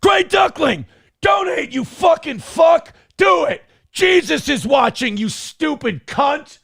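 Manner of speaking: Aaron Crash Out voice. Acted. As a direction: scream the demand like a furious maniac, pitch way up. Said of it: "in my best Aaron Crash Out voice".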